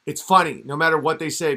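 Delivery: ordinary delivery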